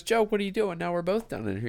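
high-pitched, incredulous voice; surprised question. Retold or imitated. Man in hole asks why his friend jumped in, high and baffled.

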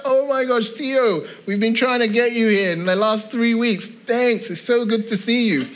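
high-pitched, mock-exclaimed welcome; retold excited greeting. Homeowner greeting the gardener who finally showed up, excited. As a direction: exclaim it high, as if greeting someone long awaited.

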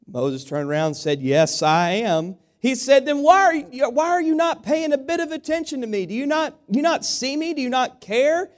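high, loud, lively joke telling to the congregation; animated joke telling. Acted. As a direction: sell the joke with big energy. Preacher tells a joke from the pulpit.

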